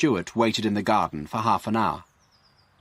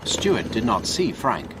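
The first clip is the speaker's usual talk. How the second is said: usual speaking voice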